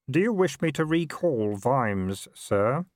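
lightly voiced clerk, slow and careful; deferential clerk. A narrator's reading. Clerk asking whether to recall Vimes, slow mild deference. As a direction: ask carefully, as an aide to a superior. Clerk asks the Patrician for orders.

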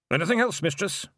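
high, polite Scottish pixie voice; a tiny Scots pixie, politely. A narrator's reading. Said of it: "said Hamish politely".

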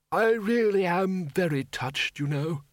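high, slow, grateful elder; touched old wizard. Acted. Undead wizard to friends, touched.

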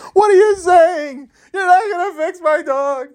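very high, wailing falsetto outrage; distraught pet owner. Pretend. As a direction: squeal a hammed-up tearful protest. Owner protests to the vet.

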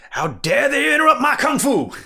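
high, loud indignant yell; furious outburst, high and loud. Retold or imitated. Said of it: "he just yells".

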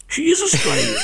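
high-pitched insistence on "is"; emphatic retold correction. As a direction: punch "is" as the acted punchline. Hairdresser's punchline correcting makeup lady.

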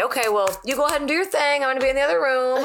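high, fast, cheerful mock send-off; breezy brush-off. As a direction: chirp it like nothing's wrong. Imagined breezy reply to depressed partner.

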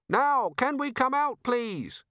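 high, slow pleading call; desperate plea. A narrator's reading. Trapped robber begging the police, calling out desperately. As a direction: call out pleadingly, pitched well up.

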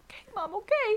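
high, meek mock child giving in; a small kid agreeing to toughen up; reluctant kid. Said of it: "And I was like".

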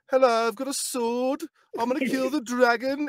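high-pitched Brummie hero; comic Birmingham-accented protagonist. Acted. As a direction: put on a sing-song Brummie, way up high. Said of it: "we were talking about Birmingham before".

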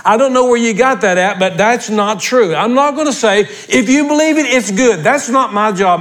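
high, emphatic pulpit delivery; fired-up preacher laying down the law; forceful preaching. Real, not acted. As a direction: preach it hard, driving each point home.

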